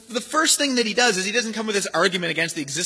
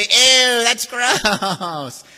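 louder sneering ew; disgusted sneer; 7 semitones higher, 6 dB louder, much slower than usual. Retold or imitated. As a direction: wrinkle your nose at it. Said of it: "they say".

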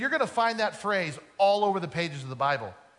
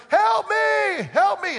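high, loud panicked cry for help; screaming for help; 13 semitones higher, 7 dB louder, much slower than usual. Retold or imitated. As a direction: yell it like you're hanging off a cliff. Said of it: "And he's screaming for".

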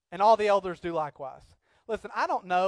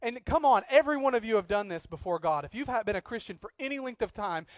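usual speaking voice

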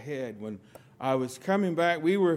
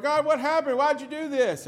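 higher, louder mock whine; whiny complaint to God; 11 semitones higher, 7 dB louder than usual. Believer turning around to blame God, high mock whine. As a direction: play the indignant believer blaming God.

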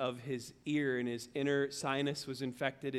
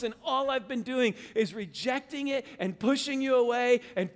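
raised, earnest prayer building in intensity; pleading confession; 6 semitones higher than usual. Acted. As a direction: pour out repentance to God, stretching the final sorry. Models confession prayer to God.

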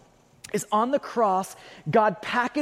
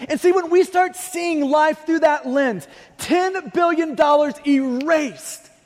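louder, higher fervent preaching; full-force preaching; 6 semitones higher, 3 dB louder than usual. Acted. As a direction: drive the point home to the congregation.

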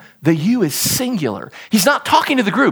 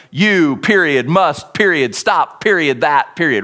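each word set apart by a spoken "period"; staccato, word-by-word emphasis; clipped emphasis; 5 semitones lower than usual. Shown as a demonstration. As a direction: read "You, period, must, period, stop, period, that, period" one word at a time. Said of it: "like us typing in all caps".

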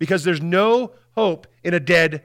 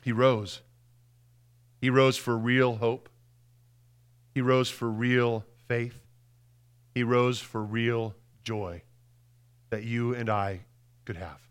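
slow, softer and lower with each line; solemn and soft; 3 semitones lower, 5 dB quieter, slower than usual. Real, not acted. Preacher speaking of hope to the congregation, slow and hushed. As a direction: let each line sink gently.

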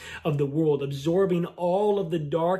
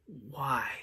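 a hushed, broken why, barely voiced; soft anguished why; 2 semitones lower, 9 dB quieter than usual. Pastor echoing a grieving father's cry, soft and broken. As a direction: let the why fall away to almost nothing. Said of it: "screaming, crying out".

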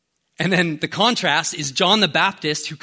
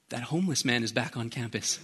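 put-on low, hushed elderly woman's complaint; old lady; 4 semitones lower, 9 dB quieter than usual. Old lady grumbling about the man on campus, hushed imitation. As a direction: drop to a soft, disapproving old lady voice. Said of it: "this eighty-something-year-old lady".

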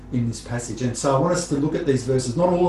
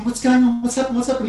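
concerned question; 9 semitones higher, faster than usual. Retold or imitated. Adult asking a crying child.

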